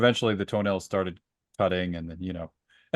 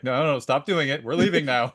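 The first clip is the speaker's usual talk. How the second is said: hammed-up stop-everything voice, pitched up; exasperated Kieran; 6 semitones higher than usual. Guest imitating Kieran halting the game, mock exasperated.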